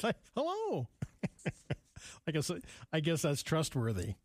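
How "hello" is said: very high-pitched startled greeting; exaggerated high hello; surprised hello. Shown as a demonstration. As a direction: shoot the pitch up in mock surprise.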